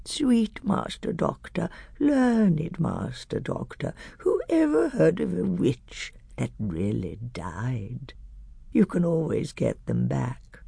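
put-on wheedling old hag, soft, slow and creepy, whispered at times; shrill whining hag. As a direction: fawn and wheedle like a sly old crone. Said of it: "the shrill whining voice began".

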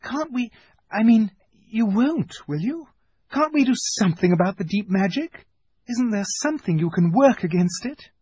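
put-on high, anxious girlish voice; Susan's whispered plea. A narrator's reading. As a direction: plead haltingly in a high, hushed tone. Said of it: "whispered Susan".

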